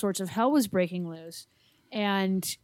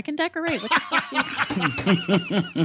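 laughing; much slower than usual. Retold or imitated. Laughing at a decorating request.

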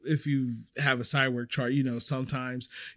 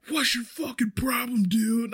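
higher, heated mock yell of an angry manager; angry retold yell; 8 semitones higher than usual. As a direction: bark it like a riled boss chasing you. Manager storms after him.

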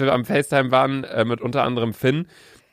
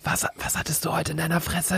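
ASMR whisper; 7 dB quieter than usual. Pretend. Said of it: "A S M R".

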